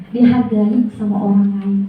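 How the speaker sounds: usual speaking voice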